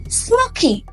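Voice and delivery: plain reading voice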